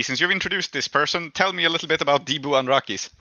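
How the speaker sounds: normal talking voice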